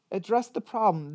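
normal talking voice

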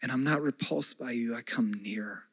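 slow, fading to a near hush; tender Jesus. Acted. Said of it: "And Jesus says".

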